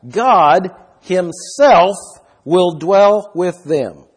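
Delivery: slow, heavy stress on "God himself"; "God himself" punched word by word, drawn out slowly; emphatic. Shown as a demonstration. Preacher stressing to listeners who will dwell with them, slow and emphatic. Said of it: "it's very emphatic".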